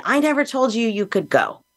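a touch of her mother's fury, half-acted; mild mock anger. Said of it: "she lost her mind on me".

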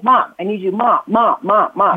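lightly voiced pestering kid; interrupting child. Child interrupting a parent's moment, lightly imitated pestering. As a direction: repeat it insistently, like a child wanting attention. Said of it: "your kids can then go and inter-, interrupt".

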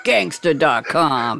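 drawn-out low announcer voice; low slow announcer. Shown as a demonstration. Host to guest, echoing his name in a slow low announcer voice. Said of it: "Say it like that".

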